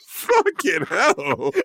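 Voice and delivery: slow, high, laughing; real amused disbelief. Real, not acted. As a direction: stretch it out through laughter. Laughing at his pronunciation.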